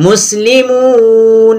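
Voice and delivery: slow, drawn-out chanted Quranic reading; tajweed recitation. Shown as a demonstration. Teacher reciting the verse ending, slow chant. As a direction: intone it slowly like recitation.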